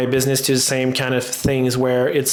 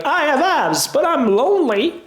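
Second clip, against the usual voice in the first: squeaky mock self; 13 semitones higher than usual. Imagined self after six months.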